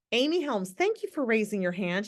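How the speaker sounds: usual speaking voice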